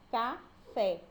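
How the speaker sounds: "café" as two beats, ca, then fé; split into syllables. Shown as a demonstration. Teacher giving a two-syllable example, "café" split in two. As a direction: say "café" with a pause between ca and fé. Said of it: "Quando a gente vai separar".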